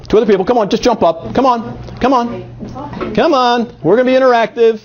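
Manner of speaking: coaxing energy. Real, not acted. Coaxing volunteers to come up.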